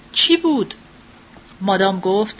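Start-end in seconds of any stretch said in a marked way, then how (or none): 0.1-0.7 higher, understated exclamation; mild narrated cry. A narrator's reading. Child crying out at a scream from the street, mild yell. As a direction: raise the pitch for a child's startled question. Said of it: "بچه فریاد زد".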